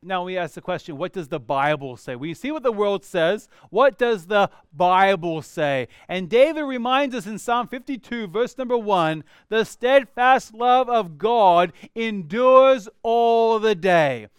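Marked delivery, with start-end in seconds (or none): 3.7-5.9 what does the Bible say? said slow and louder; louder, slower question. Acted. Repeats question with emphasis. 9.5-14.3 slow, loud proclamation of the verse; proclaimed loud and slow. Acted. Proclaims the psalm.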